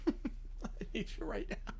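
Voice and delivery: slow, hushed words through laughter; laughing. Real, not acted. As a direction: lose the voice to quiet giggles. Cracks up repeating the line.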